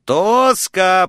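high, carrying one-word holler; shouting into courtyard. A narrator's reading. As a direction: call it out across the yard. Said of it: "прокричала миссис Гутьеррес во двор".

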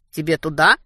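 usual speaking voice

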